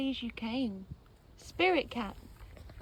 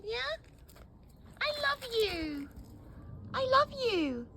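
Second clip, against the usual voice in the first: high pet talk; 12 semitones higher, slower than usual. Real, not acted. Baby talk to her cat, high and tender. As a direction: coo it up high and drawn out.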